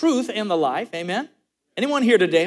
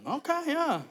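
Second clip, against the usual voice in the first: soft, high, uncertain reply; small high voice, much softer; meek retold agreement; 7 semitones higher, 10 dB quieter than usual. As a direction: play a baffled waiter humoring someone. Waiter baffled by Spanish tirade.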